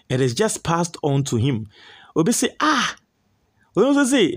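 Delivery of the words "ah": surprised ah. Retold or imitated. Reading a shocked reaction comment.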